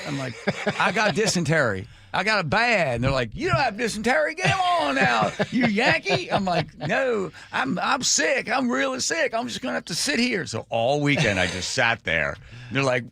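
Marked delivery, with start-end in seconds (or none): 0.8-2.9 high mock-Southern complaint; Southern accent. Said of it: "You can do a Southern accent". 3.3-6.3 very high, hammed-up Southern scolding; Southern accent. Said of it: "You can do a Southern accent". 6.8-10.4 high mock-Southern whine; Southern accent. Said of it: "You can do a Southern accent".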